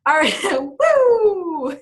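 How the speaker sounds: stretched-out laughing woo; woo cheer. Real, not acted. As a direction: whoop with a laugh. Whooping goodbye.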